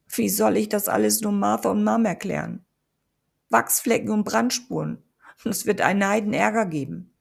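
panicked worry over the mess; worried Amber. A narrator's reading. Amber fretting after failed ritual.

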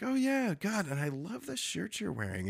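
high, airy imitation of flattery; mock gushing admiration; gushing waitress. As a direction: gush softly like a flirting waitress.

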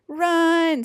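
high, loud, stretched-out yell; scream. A narrator's reading. Warthog flees Polecat's spray, screaming. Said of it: "screamed Warthog".

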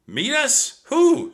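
high, rising startled questions. Acted. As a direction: Jack baffled as the wind picks up. Jack baffled by Morgan.

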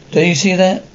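ordinary delivery, nothing marked

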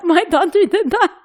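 high, laughing pride; retelling how surprised she was at her daughter, laughing; laughing proudly. Retold or imitated. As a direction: laugh through it with amused pride.

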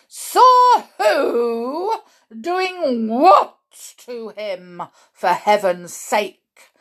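loud questions, slowed for emphasis; exasperated shout. A narrator's reading. Mr Jenkins demands sense from grandmother, shouting. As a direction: start high and loud, drawing out each question. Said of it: "shouted Mister Jenkins".

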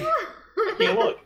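squeaky, slowed-down laughing "can't look"; high-pitched mock fear; high squeal of dread. Real, not acted. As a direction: squeal it like you can't bear to watch. Dreading the fart jar.

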